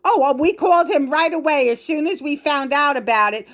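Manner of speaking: high, falsely sweet mock of the mother; mocking mother's voice. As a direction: sneer it out as her fake-nice excuse. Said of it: "my idiotic fucking mother, my biological mother, said".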